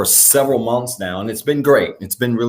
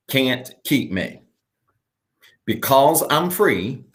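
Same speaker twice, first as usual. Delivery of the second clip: slow, measured call-and-response declaration with long pauses; slow declaration reading; slower than usual. Acted. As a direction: read each line slowly so the church can repeat. Leads the declaration, repeat after me.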